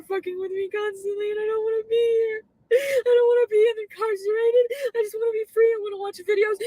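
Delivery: high, loud, sobbing plea; wailing with tears; crying. Real, not acted. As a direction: beg through real tears.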